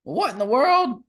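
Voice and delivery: loud, high burst of disbelief at the map; surprised. Real, not acted. Friend at a map, blurting out loud disbelief. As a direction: react like the map makes no sense.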